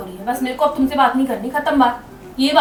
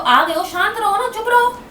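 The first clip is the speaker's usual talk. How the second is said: higher-pitched, exasperated comeback; irritated retort; 7 semitones higher than usual. Real, not acted. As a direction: cut back at him, pitch climbing. Wife snapping back in quarrel.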